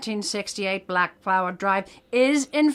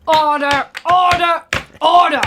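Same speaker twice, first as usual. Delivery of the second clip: loud, higher judge's bellow, each word hammered; shouting for order; 7 semitones higher, 7 dB louder than usual. Acted. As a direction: roar it down over the courtroom. Judge banging gavel to silence lawyers.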